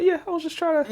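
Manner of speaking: high, breezy put-on voice of the author; mock author in interview. Imagined author shrugging in an interview, high put-on voice. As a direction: toss it off like a careless author. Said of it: "she just came out in an interview and was just like".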